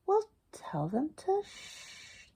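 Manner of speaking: whispered refrain; soft storybook whisper. Acted. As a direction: hush the line to the children.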